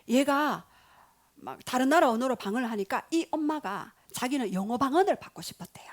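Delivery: ordinary talk, nothing marked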